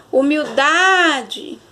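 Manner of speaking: "humildade" called slowly with a long, swelling DA; drawn-out humil-DAAA-de; stress on DA. Shown as a demonstration. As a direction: stretch and push the da of "humildade". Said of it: "Perceba que o da, a pronúncia, né, saiu mais forte".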